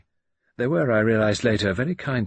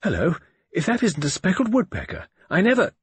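a sudden excited cry; exclaiming; 10 semitones higher than usual. Acted. Norton spots a bird through his glasses, high excited exclaim.

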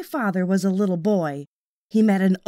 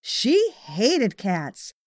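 usual speaking voice

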